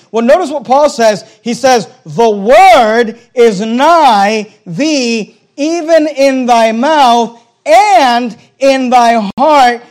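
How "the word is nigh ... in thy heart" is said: slow, drawn-out, loud declamation; stretched-out, forceful preacher's reading; emphatic scripture quotation. Real, not acted. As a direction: declaim the verse slowly, leaning on each phrase.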